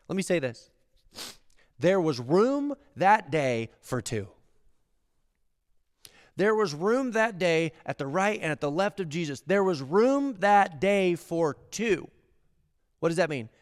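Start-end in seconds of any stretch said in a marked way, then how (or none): 1.8-4.3 slowed, firm statement; slow emphatic. Acted. Preacher states key line, slow and firm. 9.4-12.1 slow, higher, drawn out; slow emphatic. Acted. Preacher repeats key line, slow and lifted.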